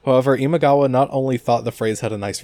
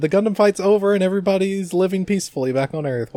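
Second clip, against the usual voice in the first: starry-eyed gushing; 9 semitones higher than usual. Pretend. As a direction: gush like an overjoyed anime hero. Gushing as Domon in happy ending.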